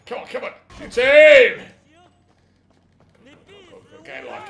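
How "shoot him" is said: loud high yell; shout. Real, not acted. Player urging the shot in the gunfight, loud yell. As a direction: bark the order.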